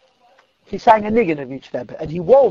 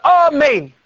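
raised, ringing amen; mock shouted amen, higher pitched; crowd's scream; 9 semitones higher, 5 dB louder than usual. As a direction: call it out as the whole room. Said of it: "everybody screams out".

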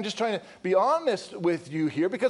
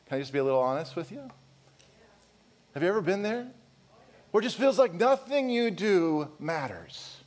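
usual speaking voice

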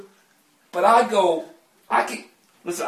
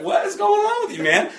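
a higher, bemused question; puzzled friend; 14 semitones higher than usual. Retold or imitated. Friend Will asking why he is laughing, a higher bemused voice. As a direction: lightly voice his friend wondering what's up. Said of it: "Will's like".

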